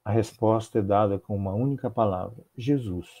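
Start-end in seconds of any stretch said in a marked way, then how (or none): none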